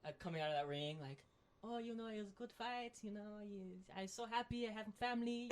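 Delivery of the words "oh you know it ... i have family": high, put-on interview voice; high-voiced nice-person imitation; sweet fighter impression. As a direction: play the humble, happy winner, pitched up.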